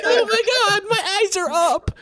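high, loud screaming fan imitation; mock fan freakout. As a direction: ham up a fan losing it at a reveal. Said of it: "like those Smash reactions".

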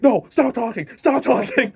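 high, loud repeated order; mock yell. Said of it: "Mike was like".

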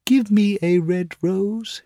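high, slow pleading bird; nightingale crying out. Acted. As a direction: beg the rose tree. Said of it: "she cried".

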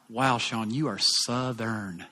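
low, slowed mock amazement; wry self-critique. Preacher reacting to his own recorded accent, wry and low. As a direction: drop low and stretch out the last words. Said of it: "listening to myself and I'm like".

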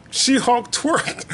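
"She-Hulk twerked" drawn out slowly; slowed-down amazed repeat. Shown as a demonstration.

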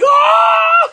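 very high, loud shriek; startled scream. Retold or imitated. Friend startled by a machine grabbing his arm, loud shriek. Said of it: "and I was like".

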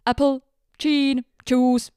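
apple, gin, juice with a second's pause after apple and gin said chin; Finnish-accented English. Retold or imitated. Retelling how the Finnish passenger ordered, accented English. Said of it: "siinä oli sekunnin tauko sanan apple jälkeen".

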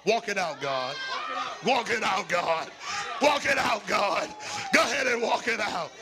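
high, loud shouted sermon over music; climactic preaching. Acted. Preacher calling on God to walk it out, shouting over the band.

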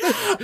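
high, loud imitated yell; mock yell. Retelling the car driving past, high mock yell. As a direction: yelp it out high, playing the story. Acting out yelling as car passes.

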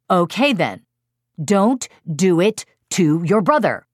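loud, emphatic parental order; firm scolding. Retold or imitated. Parent scolds son about poking his brother, loud and firm. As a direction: lay down the law, punching the words.

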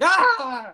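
a startled yelp; retold scream. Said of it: "I just screamed".

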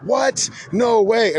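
high, startled what no way; a gasp of disbelief at the news; amazed disbelief. Retold or imitated. Gods learning of the pantheon, amazed disbelief.

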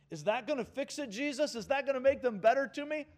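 mock whine; whiny protest. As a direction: push back like a sulking kid. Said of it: "arguing flesh asks Jesus".

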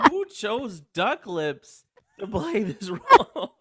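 high, loud, slow incredulous question; loud, high-pitched comic indignation; mock outrage. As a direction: demand it in hammed-up disbelief. Acted incredulous reaction, both laughing.

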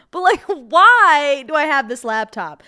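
loud, slow, laughing outrage; laughing through a crazed rant; crazy voice. Acted. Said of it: "I'm getting into my crazy voice right now".

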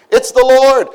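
John excitedly recognizing the Lord; excited realization. Retold or imitated. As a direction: call out the discovery. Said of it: "loved the Lord".

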